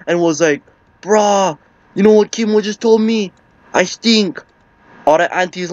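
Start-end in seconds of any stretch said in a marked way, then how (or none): 1.0-4.4 high-pitched, wounded complaint; mock crying. Crying uncle complaining about Kimo in the cockpit, mock crying. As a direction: imitate an upset uncle without real sobs. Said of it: "My uncle was all crying".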